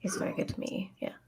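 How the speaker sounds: hushed, breathy greeting; whispered greeting. Acted. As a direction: whisper politely to the thing in the crate. Whispering to caged dragon.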